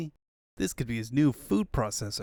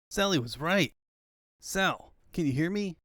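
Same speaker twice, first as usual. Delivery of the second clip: usual speaking voice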